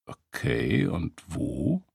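low, quiet note of irritation; understated irritation, held low and soft; irritated. A narrator's reading. Michael questions the beggar's story, irritated. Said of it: "fragte Michael irritiert".

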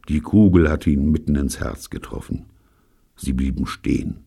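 plain reading voice

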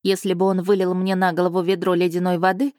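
plain reading voice

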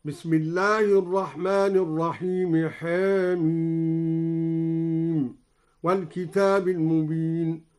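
Quranic chant, "حاميم" with a slightly fronted a; taqlil recitation. Shown as a demonstration. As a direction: tilt the first vowel of "حاميم" halfway to e, then hold it long. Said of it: "قلل الدوري الحاء من حاميم هكذا".

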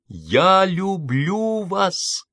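high, very slow triumphant declaration; triumphant cry. Acted. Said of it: "Торжествующе крикнула". (торжествующе, протяжно и высоко)

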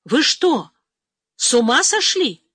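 loud roar of outrage. A narrator's reading. Lieutenant colonel roars at his commander, loud outraged roar. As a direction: bellow it at him in disbelief. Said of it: "взревел подполковник".

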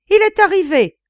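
very high, loud exclamation; excited cry. A narrator's reading. Duchess announcing her guest's arrival, excited high cry. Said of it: "s'écria la duchesse".

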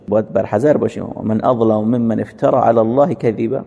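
usual speaking voice

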